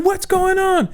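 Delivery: very high, frantic mom; panicked mom. Retold or imitated. Mom behind the wheel hearing her child scream, frantic. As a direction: squeal it in a panic. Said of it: "And she's like".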